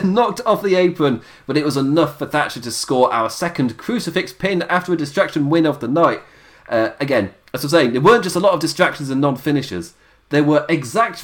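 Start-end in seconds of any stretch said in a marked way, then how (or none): none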